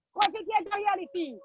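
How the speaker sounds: ordinary talk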